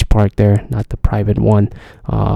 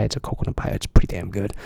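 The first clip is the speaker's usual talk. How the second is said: ordinary talk, nothing marked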